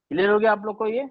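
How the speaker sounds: ordinary talk, nothing marked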